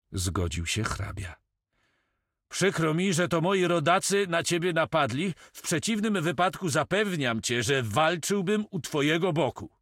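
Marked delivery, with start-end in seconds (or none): 2.5-9.7 a higher, courteous nobleman's voice; voice of the count. A narrator's reading. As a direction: speak as an apologetic Dutch count, with dignity. (hrabia, wyższym, uprzejmym głosem)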